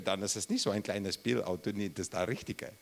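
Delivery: ordinary talk, nothing marked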